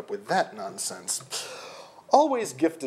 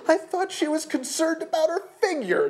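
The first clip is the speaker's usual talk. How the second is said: very high-pitched, indignant snarl. A narrator's reading. Jeff scoffing at the contract penalty, snarling. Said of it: "Jeff snarled".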